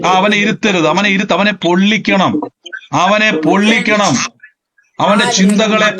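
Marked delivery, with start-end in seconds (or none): none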